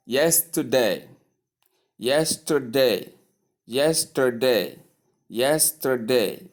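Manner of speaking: "yesterday" in three measured syllables, stressed on YES; model pronunciation. Shown as a demonstration. Teacher repeating the word for learners, model pronunciation. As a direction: say yesterday slowly as YES-ter-day, r-coloured middle. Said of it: "Do you get that?".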